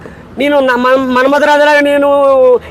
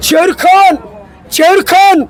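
Ram Charan dialogue; 4 semitones higher, faster than usual. Acted. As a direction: roar the challenge like a warrior hero. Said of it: "Ram Charan, Ram Charan".

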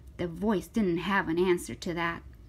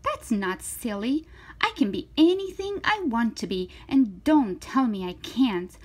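put-on defiant little-girl character, higher and lively; emphatic, swooping storybook voice; reading to children; 7 semitones higher, 9 dB louder, faster than usual. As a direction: read it bold and animated. Reads to children, voicing Zoe.

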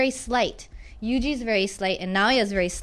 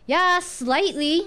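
slow, drawn yeah rising, dry understatement; sarcastic; 6 semitones higher, much slower than usual. Shown as a demonstration. Teacher demos a sarcastic reply about a bad driver, dry and slow. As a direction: say it dry and slow, clearly not meaning it. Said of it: "That's皮肉っぽい、sarcastic".

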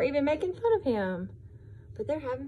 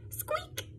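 very high, soft squeak; small quiet squeak; tiny mouse squeak; 11 dB quieter than usual. Acted.

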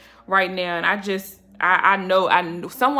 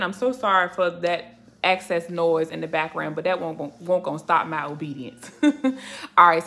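normal talking voice

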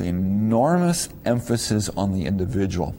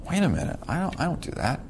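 high, indignant imagined student objecting; mock protest; 7 semitones higher, 5 dB quieter, faster than usual. Professor voicing a student's objection, mock indignant protest. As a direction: play a defensive listener brushing off the idea. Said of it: "you might say".

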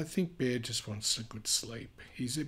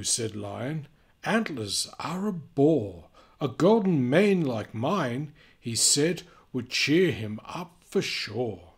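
lively storybook reading voice; animated read-aloud for kids; reading to children; 4 semitones higher, 7 dB louder than usual. A narrator's reading. Reading picture book aloud.

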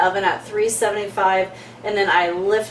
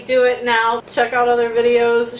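ordinary talk, nothing marked

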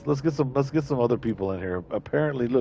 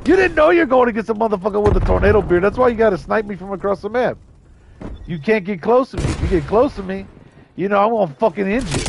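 loud, higher-pitched taunting rant; heated trash talk; 7 semitones higher, 3 dB louder than usual. Real, not acted. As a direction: fire off cocky taunts at the enemy.

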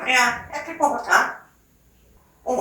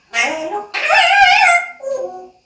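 high cooing; 11 semitones higher, 5 dB louder than usual. Real, not acted. Cooing at the pet.

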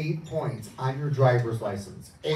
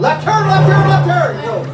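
very high, loud yell of the turn; shouted warning; 17 dB louder than usual. Pretend. Hypnotist yelling directions to volunteers, high panicked shout. Shouting turns during imaginary drive.

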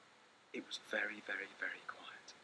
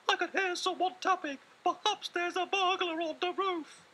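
calling little mouse; 10 dB louder than usual. Acted. Little Mouse calling his brother at night, high squeaky call. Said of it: "called Little Mouse".

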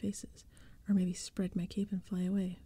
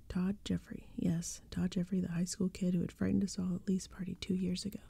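ordinary delivery

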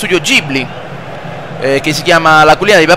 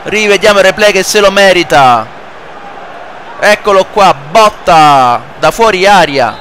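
live play-by-play; 3 semitones higher than usual. Acted. Plays football video game commentating.

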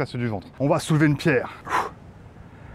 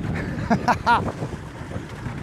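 laughing; 7 semitones higher, 3 dB quieter than usual. Real, not acted. Laughing at the prank.

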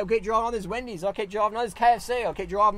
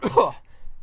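one rough, breathy chesty cough; 6 dB louder than usual. Real, not acted. Vlogger with a wintry chest, coughing mid-rant. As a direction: clear a heavy chest with a cough. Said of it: "Bit chesty today".

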